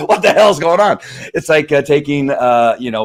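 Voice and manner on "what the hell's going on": high, loud startled shout; freaked-out dog. Acted. Voicing a dog overwhelmed on its first walk, alarmed high shout. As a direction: cry out in alarmed bewilderment. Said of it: "they're going".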